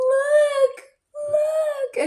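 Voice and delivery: very high, loud, drawn-out call; excited squealing. Retold or imitated. Women show snakes to incoming men, high squeal. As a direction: squeal it as if showing off treasure.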